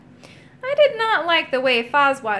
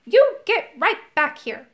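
scolding; 6 semitones higher, 4 dB louder than usual. A narrator's reading. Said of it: "he yelled".

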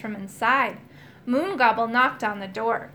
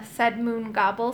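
plain reading voice

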